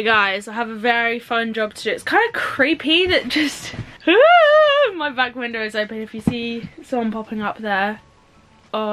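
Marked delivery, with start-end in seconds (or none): 4.0-4.9 loud, high nervous cackle; high shriek of laughter. Real, not acted. Spooked by her open back window, a loud nervous laugh. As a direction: burst into a shrill nervous laugh.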